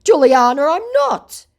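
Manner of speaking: high, loud, pleading mock denial; mock scammer pleading. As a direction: whine her name and the denial. Scammer quoted, insisting.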